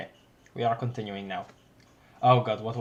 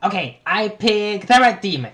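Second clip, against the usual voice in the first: excited character voice; 6 semitones higher, 8 dB louder than usual. Acted. Character line read aloud.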